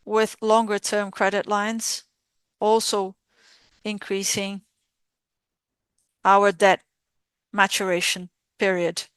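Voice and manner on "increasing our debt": ordinary delivery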